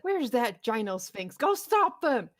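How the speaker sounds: high, loud panicked shouting; frantic villain call. Acted. Intercom villain shouts for the Gyno Sphinx to stop them, loud and high. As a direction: yell for the monster in a panic. Villain calls his monster.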